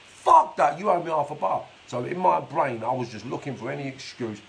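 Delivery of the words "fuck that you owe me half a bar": sharp, much higher and louder outburst of indignation; mock outraged shout. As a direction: bark it like a greedy gangster who feels robbed. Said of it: "That's like".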